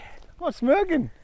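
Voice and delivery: high-pitched, drawn-out protest; protesting denial. Real, not acted. Golfer accused of smirking by opponent, high-pitched protest. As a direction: stretch the denial up high in mock innocence.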